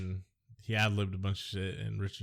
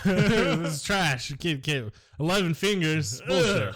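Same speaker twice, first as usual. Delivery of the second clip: mock villainous boss; 7 semitones higher, 4 dB louder than usual. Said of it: "Like when he rips the kid's picture".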